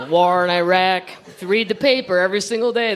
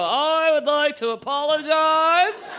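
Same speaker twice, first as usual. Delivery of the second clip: mock stiff John Kerry; 5 semitones higher than usual. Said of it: "come out and go".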